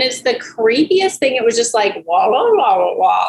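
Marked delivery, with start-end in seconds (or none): 2.1-3.3 creepy voice. Retold or imitated. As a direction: imitate a creepy robotic voice. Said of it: "like, this really creepy voice".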